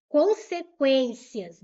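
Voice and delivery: loud, slow "Consequências", said as a whole word; loud emphatic word. Shown as a demonstration. Teacher returning to the example for students, loud emphatic word. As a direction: put "Consequências" out firmly and loudly.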